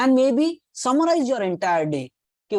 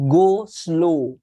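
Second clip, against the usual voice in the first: loud, drawn-out words; firm and deliberate, pitched lower; slow, emphatic instruction; 4 semitones lower, 5 dB louder than usual. Shown as a demonstration. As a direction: press each word home slowly.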